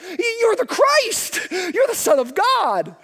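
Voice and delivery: high, slow eager blurt. Acted. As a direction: blurt it out like the keen student. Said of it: "that blurts out".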